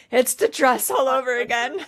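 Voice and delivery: betrayed. Retold or imitated. Fan reacting to the ugly dress, mock betrayed. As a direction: exclaim it like you've been betrayed. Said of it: "I was, like, betrayed".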